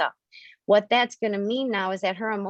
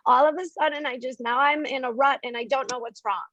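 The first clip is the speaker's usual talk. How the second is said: higher, lost and bewildered inner voice; voicing the emotional self; 5 semitones higher than usual. The emotional self answers the analytical self, high and confused. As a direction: play the confused feelings, high and helpless. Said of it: "And the emotional self is like".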